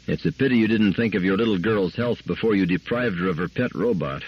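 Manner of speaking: plain reading voice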